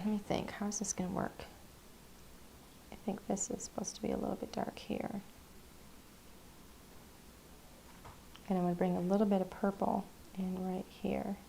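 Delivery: whispered, barely voiced while concentrating; focused whisper. Real, not acted. Painter lost in her work, murmuring to herself. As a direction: mumble it to yourself over the canvas. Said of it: "I'm getting really quiet because I'm focused".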